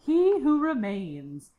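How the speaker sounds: slow, high-pitched chipper put-on accent; Miss Minutes, bad accent. Podcaster imitates the character naming her boss, chipper bad accent. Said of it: "sorry for my accent".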